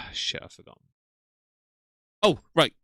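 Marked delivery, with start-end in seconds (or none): 2.2-2.7 loud "oh right"; sudden realization. Real, not acted. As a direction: blurt it out as it comes back. Suddenly remembering.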